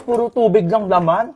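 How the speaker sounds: normal talking voice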